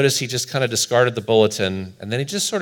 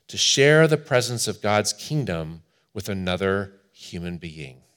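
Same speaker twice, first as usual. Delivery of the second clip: ordinary talk, nothing marked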